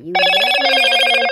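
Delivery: loud yelled complaint; shouting. Pretend. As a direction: yell it out. Toy quarrel, shouting.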